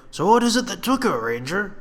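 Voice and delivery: high, eager youth voice; reedy young soldier. Acted. Reedy youth asking the ranger, high and eager. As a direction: pitch it up like a nervous young recruit.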